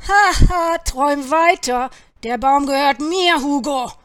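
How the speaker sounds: loud, jeering crow in a fight; mock loudly, then claim it angrily; angry, scornful yelling; furious shout. A narrator's reading. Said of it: "schrie der andere wütend".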